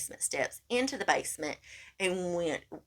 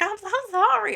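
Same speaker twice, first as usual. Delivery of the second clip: much higher, mock-wailing apology; pleading wail; 6 dB louder than usual. Woman retelling her bathtub prayer, high mock wail. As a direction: cry it up high like a desperate prayer.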